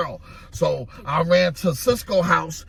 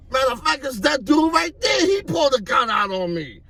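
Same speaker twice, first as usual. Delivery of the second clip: shouted accusation; 10 semitones higher than usual. Retold or imitated. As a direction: yell it, naming the guy who pulled the gun. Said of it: "I'm like".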